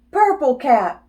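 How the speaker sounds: ordinary delivery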